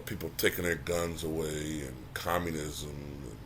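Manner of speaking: ordinary delivery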